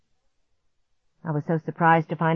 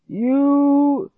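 high, loud, very slow strangled voice; choking monster; 8 semitones higher, 6 dB louder, much slower than usual. A narrator's reading. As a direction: force the words out, choking. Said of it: "he choked out".

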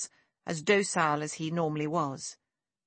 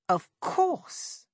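sudden, much higher cry of realization; exclaimed out loud; 12 semitones higher than usual. A narrator's reading. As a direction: blurt it out as it finally clicks. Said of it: "he exclaimed out loud".

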